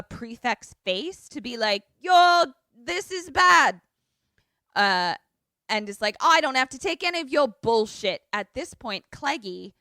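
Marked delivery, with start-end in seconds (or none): 2.0-3.8 high, loud, drawn-out warning; hammed-up protester yell; mock confrontation. Hippie in a prefect's face. 6.2-8.2 raised, higher retort; playfully indignant; mock defiance. Voicing a quarrel at the confrontation.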